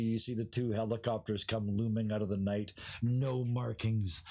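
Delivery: ordinary talk, nothing marked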